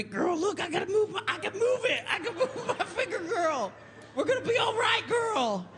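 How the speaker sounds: high falsetto cheer; excited camp squeal. Retold or imitated. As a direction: squeal with relief. Friend excitedly moves finger.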